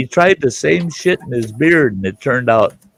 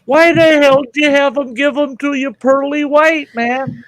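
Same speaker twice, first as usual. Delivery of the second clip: incredulous scolding. Retold or imitated. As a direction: needle him for choosing pearly whites. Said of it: "I said".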